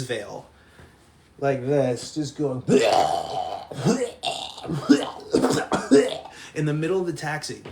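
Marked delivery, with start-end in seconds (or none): 2.7-6.5 repeated loud puking noises; imitated retching. Imitating Jimmy puking into his veil, loud retches. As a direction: act out heaving over and over. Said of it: "is just puking into his veil".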